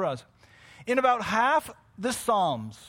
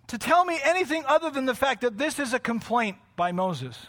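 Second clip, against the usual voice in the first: ordinary delivery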